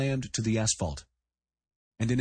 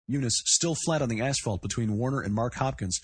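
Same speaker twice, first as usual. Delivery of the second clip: ordinary delivery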